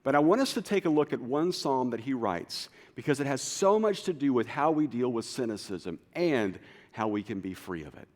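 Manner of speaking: ordinary talk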